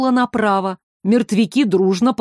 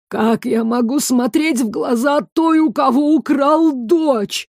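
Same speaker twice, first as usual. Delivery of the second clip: shaky, slowing on the end; trembling voice; 4 semitones higher than usual. A narrator's reading. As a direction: voice breaking with guilt. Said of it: "дрогнувшим голосом". (дрогнувшим голосом)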